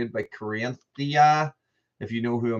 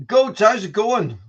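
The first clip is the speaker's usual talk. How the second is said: playful greeting; 8 semitones higher, 5 dB louder, slower than usual. Real, not acted. Greeting goats, higher.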